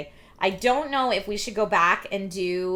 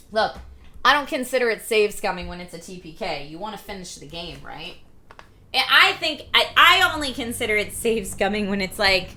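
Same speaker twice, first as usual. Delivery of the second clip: ordinary talk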